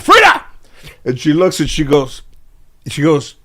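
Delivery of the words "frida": high, loud snap of the name; sharp call. Retold or imitated. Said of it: "she's like".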